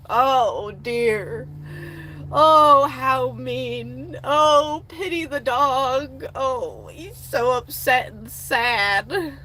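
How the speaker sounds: loud, slow hammed-up sympathy; mock pity. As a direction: ham up the pity for the spoiled dog. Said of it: "look how much distress he's in".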